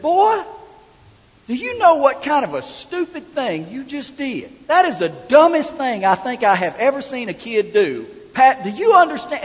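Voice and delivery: high, loud acted dressing-down; scolding officer. [name] chewing out Will at the car window, scolding. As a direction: tear into the boy like an outraged officer.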